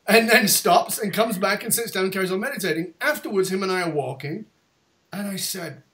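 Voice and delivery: ordinary talk, nothing marked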